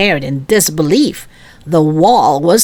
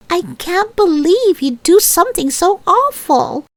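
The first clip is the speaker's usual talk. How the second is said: shocked outcry; 12 semitones higher than usual. A narrator's reading. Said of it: "Lisa cried".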